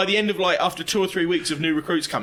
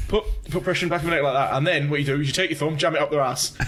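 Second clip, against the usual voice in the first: ordinary talk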